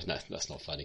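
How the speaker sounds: breathy whisper, soft, to himself; whispered aside. Real, not acted. Muttering a self-correction under his breath after the joke.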